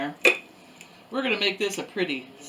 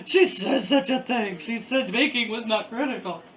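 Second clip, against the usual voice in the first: hammed-up French baker voice, higher, laughing; outraged French pastry chef; 5 semitones higher than usual. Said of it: "some baker out there pulling his hair out saying".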